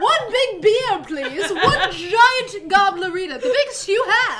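hammy bar order. Acted. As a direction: ham up a huge drink order.

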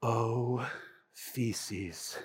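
muttered very softly, low; under his breath. Retold or imitated. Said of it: "under his breath said".

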